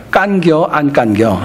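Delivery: slow, drawn-out Chungcheong country drawl; Chungcheong dialect. Retold or imitated. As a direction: ask it lazily in Chungcheong dialect. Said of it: "충청도 분들은 아주 심플합니다". (느릿한 충청도 사투리로)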